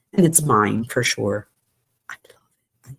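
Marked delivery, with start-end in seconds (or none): 2.1-3.0 hushed confiding whisper; whispered aside, as if confessing. Real, not acted. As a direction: drop to a secret whisper. Aside about keeping the blender.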